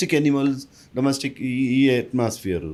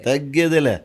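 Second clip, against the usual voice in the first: louder, punched తగ్గేదేలే in a star's catchphrase style; Allu Arjun catchphrase; 5 dB louder than usual. Retold or imitated. Echoing the interviewer, showing a star's catchphrase with swagger. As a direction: fling out తగ్గేదేలే like the film hero. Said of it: "మీరు చెప్పేస్తారు అల్లు అర్జున్ అని".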